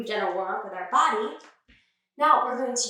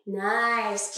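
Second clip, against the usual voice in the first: ordinary delivery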